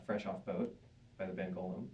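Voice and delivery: normal talking voice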